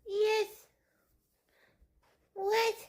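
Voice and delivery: very high cooing voice; baby talk. Acted. Owner gushing at her cat in high baby talk. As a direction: gush at the cat in a tiny voice.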